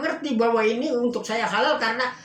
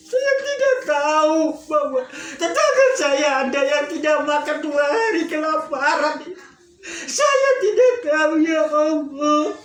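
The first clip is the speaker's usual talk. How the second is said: high sobbing lament, louder; crying lament to God, much higher; retold sobbing; 5 semitones higher, 4 dB louder than usual. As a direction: wail out the guilt, voice breaking. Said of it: "Dia nangis".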